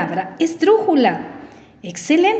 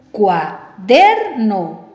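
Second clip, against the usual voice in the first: "Cuaderno" in separate slow syllables, long raised DER; stress on middle syllable; 2 semitones lower, much slower than usual. Shown as a demonstration. Teacher repeats for pupils, "Cuaderno" stressed on DER. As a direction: lean hard on DER in "Cuaderno", pausing between syllables. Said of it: "en la penúltima sílaba".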